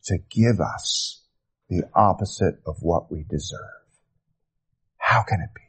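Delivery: low, soft, sinking to a whisper; hushed awe. Real, not acted. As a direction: let it fall away into awed near-whisper. Preacher in awe at undeserved grace.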